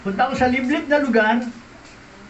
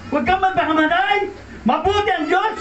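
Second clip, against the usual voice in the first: higher urgent cry; urgent plea; 7 semitones higher than usual. Retold or imitated. Friend slapping Rodel and begging him not to die. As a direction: plead urgently, high. Friend stopping his suicide.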